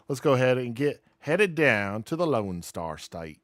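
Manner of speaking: put-on half-baked Texas accent to head for the Lone Star State; goofy attempt at a Texas accent; mystery put-on accent. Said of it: "I have no idea what that accent was supposed to be".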